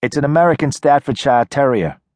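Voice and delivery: put-on wary girl calling from a porch; tough city girl. Nadia voiced by narrator.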